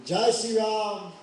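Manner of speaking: high, loud and slow, drawn out devotional cry; slow ringing call. Acted. Lakshman calls out joyfully while bowing to Ram. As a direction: call the name out like a prayer shout.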